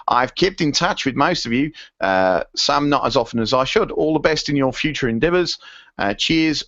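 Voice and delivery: half-hearted go at an Aussie voice; Aussie accent. Acted. Podcast host signing off a listener's email, in a wobbly Aussie accent. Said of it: "read this in an Aussie accent".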